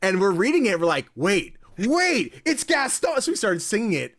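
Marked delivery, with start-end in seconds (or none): 1.2-3.2 high-pitched reenacted excitement; excited realization. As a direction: act out a sudden delighted discovery. Acting out the group's excited discovery.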